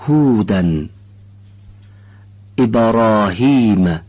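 slow, careful Quranic recitation of each word; demo words. Shown as a demonstration. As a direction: pronounce each word deliberately, stretched.

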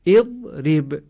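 اضرب read with an opening "i" vowel, "iḍrib"; model pronunciation. Shown as a demonstration. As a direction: read اضرب for the class, starting with "i". (اضرب را با کسره بخوان)

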